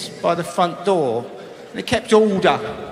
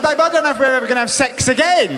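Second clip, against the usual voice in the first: laughing; 6 semitones higher, faster than usual. Retold or imitated. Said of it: "I said".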